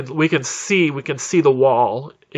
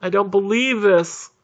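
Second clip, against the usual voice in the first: high, drawn-out wail of overwhelmed relief; mock sobbing; 6 semitones higher, slower than usual. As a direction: imitate weeping survivors, gasping, slow and high. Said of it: "people are cheering and crying and hugging".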